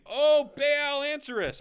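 mock scream. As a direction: call out as if begging a silent god. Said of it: "they're screaming".

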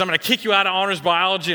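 imitated angry teacher, high and loud; reaming out. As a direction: play a teacher laying down a threat. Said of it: "she reams me".

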